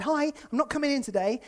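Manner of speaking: bright, casual caller voice; a hint of a carefree employee calling in; breezy Hazel. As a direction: play it cheery and careless. Acted phone call from Hazel.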